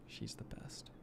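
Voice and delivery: soft whisper. Real, not acted. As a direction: say it under your breath. Whispered aside about sister baking.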